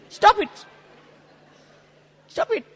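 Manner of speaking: high-pitched, re-enacted order to stop; retold sharp rebuke. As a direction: act out scolding the mourners, pitched well up. Retelling her rebuke to crying mourners.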